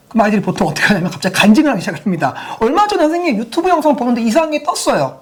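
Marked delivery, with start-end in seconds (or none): 2.6-5.2 imitating a child. Child confessing, acted out.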